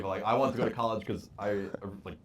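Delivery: ordinary talk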